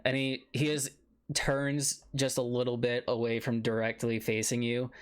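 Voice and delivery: ordinary talk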